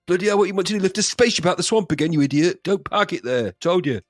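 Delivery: annoyed cockney Yoda telling someone off; cocky East End Yoda. Acted. Yoda berating whoever parked a spaceship, angry East Ender. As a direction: scold like an irritated East End geezer. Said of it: "It's, it's Yoda's".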